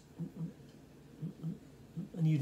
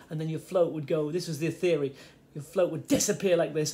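ordinary talk

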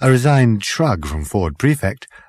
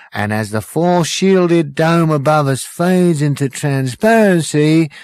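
high, bright-eyed compere patter, louder; showbiz host; 6 semitones higher, 5 dB louder than usual. Acted. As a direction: hype the crowd like a cabaret host. Compere hosting the end of the universe.

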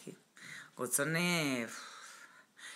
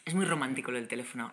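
usual speaking voice

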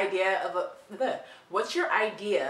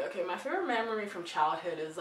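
ordinary talk

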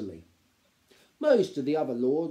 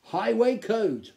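mildly unsure "Highway Code", higher and slower; slow, higher, slightly doubtful title; uncertainly; 9 semitones higher, 6 dB louder, slower than usual. A narrator's reading.

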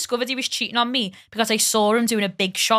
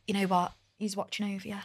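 imitating consoling platitude; 2 semitones lower, 10 dB quieter than usual. Granddaughter quotes what people say when someone dies, in a soft mock-consoling voice. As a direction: say it softly, as others would. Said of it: "so, like, people go".

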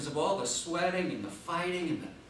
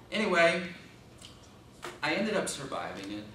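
ordinary talk, nothing marked

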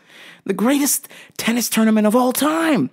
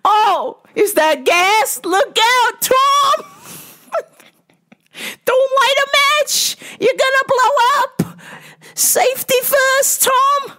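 put-on very high, drawn-out falsetto old lady; hammed-up fretful old woman; old lady moviegoer yelling; slower than usual. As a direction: squeal warnings at the movie screen. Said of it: "yelling at the theater".

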